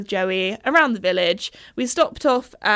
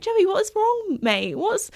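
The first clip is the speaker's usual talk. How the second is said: puzzled plea; 10 semitones higher, slower than usual. Retold or imitated. Asking horse what's wrong.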